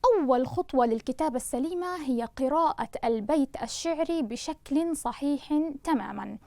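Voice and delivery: ordinary delivery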